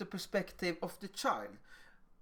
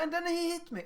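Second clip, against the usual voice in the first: put-on high-pitched child's voice; child complaining; 9 semitones higher, faster than usual. Child finishing the story for an adult, high-pitched complaint. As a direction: add it high, like a wronged child.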